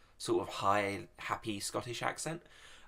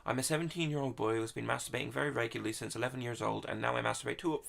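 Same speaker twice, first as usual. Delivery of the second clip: usual speaking voice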